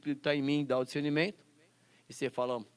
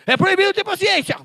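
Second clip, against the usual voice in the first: "É proibido ter paciência" jumps much higher, loud, peaking on "proibido"; much higher mock voice, loud; 13 dB louder than usual. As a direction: ham it up as a silly squeaky official. Repeats ban, mocking higher.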